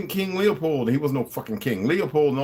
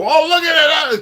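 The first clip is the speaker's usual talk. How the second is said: much higher and louder, a gushing fake amazement; mocking awe; 10 dB louder, slower than usual. Mocking people who need a visual, with gushing fake wonder. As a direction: squeal it like a gullible fan.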